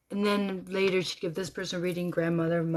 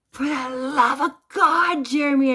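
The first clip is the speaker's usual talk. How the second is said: screaming inwardly; 8 semitones higher, 9 dB louder than usual. Retold or imitated. Widow begging her late husband inwardly, acted-out scream. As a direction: cry it out in full-voiced desperation. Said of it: "I scream in my head".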